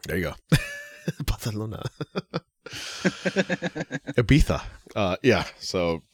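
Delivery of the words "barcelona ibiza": Barcelona and Ibiza with a Castilian th lisp; mock Spain accent, th for the c and z; Castilian Spanish lisp. Teasing co-host about a Spain place name, Castilian lisp. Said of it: "I fucking hate that accent so much".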